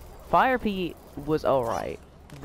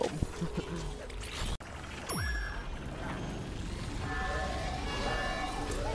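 breathy laugh; 8 dB quieter than usual. Real, not acted. Laughs after the kill.

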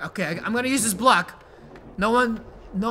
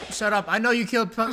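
ordinary talk, nothing marked

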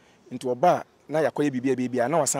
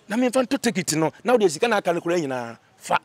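normal talking voice

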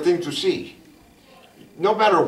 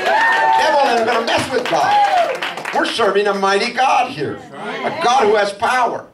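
raised, drawn-out preaching voice; fervent preaching; 6 semitones higher than usual. Acted. Pastor telling the congregation how mighty God is, fervent. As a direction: lift the church up with slow emphasis.

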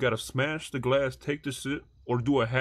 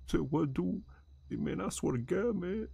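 higher, softer put-on character voice; hushed, pitched-up cartoonish dude; peanut-voice whisper; 4 semitones higher, 6 dB quieter, slower than usual. Shown as a demonstration. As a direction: try whispering while keeping a silly high voice. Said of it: "whisper in my peanut voice".

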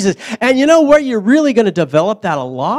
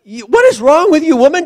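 loud laughing "what is wrong with you"; laughing rebuke; 9 semitones higher, 5 dB louder than usual. Retold or imitated. Preacher voicing Jesus to Martha, laughing. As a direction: tease through a laugh.

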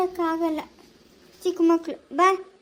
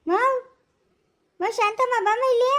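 calling out for help. Acted. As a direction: shout for help across the street. Granny calling neighbours about thief.

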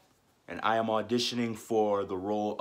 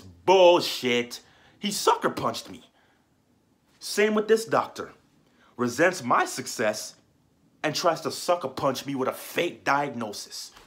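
louder, higher burst of outrage; indignant outburst; 4 semitones higher, 6 dB louder than usual. Acted. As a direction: spit out the accusation with punched, emphatic key words. Audition monologue, indignant outburst over doctor.

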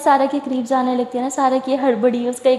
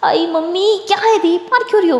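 pained protest; 6 semitones higher than usual. A narrator's reading. Sara slapped by her elder sister, high pained protest. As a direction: cry out as if just smacked, acted. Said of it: "रख के थप्पड़ लगाती है".

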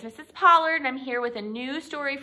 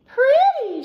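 high, loud, drawn-out praise; admiring cow; 11 dB louder than usual. A narrator's reading. Cow admiring her idea on Douglas's hat, high admiring voice. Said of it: "she said".

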